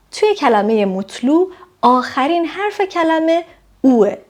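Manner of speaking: ordinary delivery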